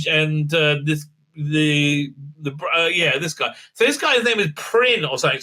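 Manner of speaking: usual speaking voice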